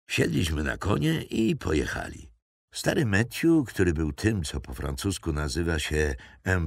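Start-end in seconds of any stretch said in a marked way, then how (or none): none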